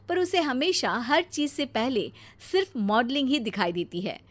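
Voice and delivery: ordinary delivery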